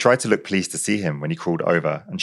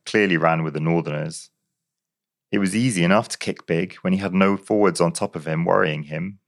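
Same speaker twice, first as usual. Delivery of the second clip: ordinary delivery, nothing marked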